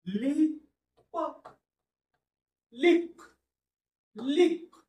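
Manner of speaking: लिप called out well above speaking pitch; high word model. Shown as a demonstration. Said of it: "शब्दाचं वाचन करा". (लिप उंच स्वरात उच्चार)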